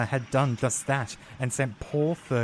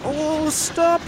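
much higher, louder panicked yelp; alarmed cry; 6 dB louder than usual. A narrator's reading. Fergus swerving off the line, crying out in alarm. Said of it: "he cried".

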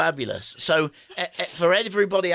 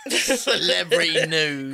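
slow, louder, through laughter; laughing echo; 2 semitones higher, 7 dB louder, much slower than usual. Retold or imitated. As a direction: draw it out while cracking up. Laughingly echoes partner's phrase.

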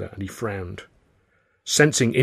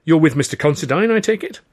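bright greeting; 7 semitones higher, 6 dB louder than usual. A narrator's reading. Narrator greets butler brightly.

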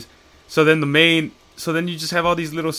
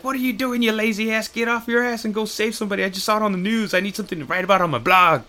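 high, yelling boss berating a lazy employee; hammed-up Jonah tirade pitched way up; ranting Jonah; 8 semitones higher than usual. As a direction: bark at him to get off his ass. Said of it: "Jonah calls him, and he's like".

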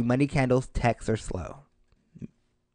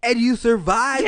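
laughing; 9 semitones higher, 6 dB louder, slower than usual. Real, not acted. Laughing at hair fire story.

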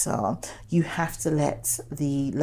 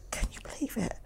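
whispering; 6 semitones higher, 12 dB quieter, much faster than usual. Retold or imitated. Retelling shocked disbelief.

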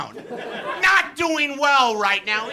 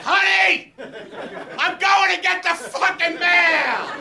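comic overwrought plea, pitched way up; mock desperate holler; 5 semitones higher than usual. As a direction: holler a melodramatic last farewell to your girlfriend. Melodramatic farewell to girlfriend.

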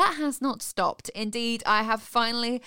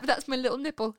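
ordinary talk, nothing marked

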